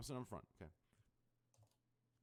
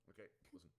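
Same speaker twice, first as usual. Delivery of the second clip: quiet; 6 semitones lower, 15 dB quieter, faster than usual. Shown as a demonstration.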